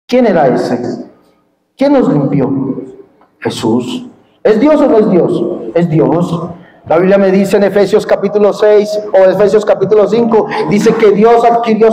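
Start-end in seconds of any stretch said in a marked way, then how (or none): none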